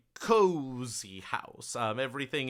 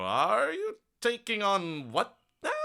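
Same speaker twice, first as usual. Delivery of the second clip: startled father; 10 semitones higher, 3 dB louder than usual. Acted. Father surprised by her plan.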